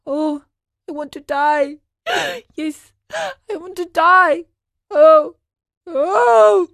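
high wailing cries with gasps; gasping, tearful outburst of grief; sobbing. A narrator's reading. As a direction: wail in despair, building to loud moans. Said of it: "He sobbed as he said to himself".

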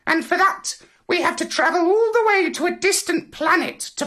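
very high, squawking parrot character. A narrator's reading. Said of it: "said the parrot".

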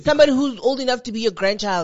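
fast, loud, disgusted jab; outraged. Real, not acted. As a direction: spit it out in disbelief. Outraged rant about age gap.

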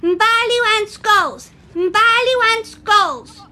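put-on very high, slow little girl's voice; a small child insisting, drawn out; toddler voice. Little Mbali demands goals from her friends. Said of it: "repeated Mbali".

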